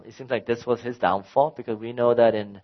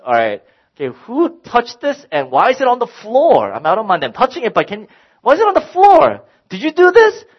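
higher and louder, exasperated interrogation; angry dad; 10 semitones higher, 7 dB louder than usual. Retold or imitated. Dad confronting his kids about the remote, angry and loud. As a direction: demand answers from the kids, heating up.

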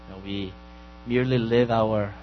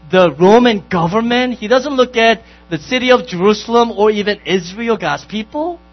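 full-force preaching; 8 semitones higher, 13 dB louder than usual. Acted. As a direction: proclaim it, leaning hard on each word. Pastor preaching on salt and light.

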